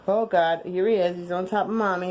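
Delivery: ordinary talk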